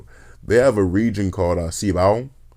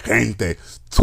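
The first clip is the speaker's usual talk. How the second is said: gargled Castilian Spanish throat sound; mock Spaniard accent. Mocking the Spain accent, gargled Castilian throat rasp. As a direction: exaggerate the raspy J of Spain. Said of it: "they're just gargling from them".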